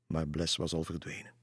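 usual speaking voice

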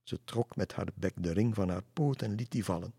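plain reading voice

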